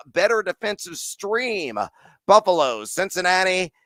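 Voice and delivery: ordinary talk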